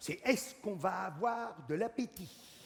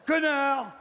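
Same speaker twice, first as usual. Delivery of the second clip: higher, louder mock snap of an insult; shouted insult; 6 semitones higher, 6 dB louder than usual. Comedian to the audience, shouted mock insult. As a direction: fling the word out as a punchline.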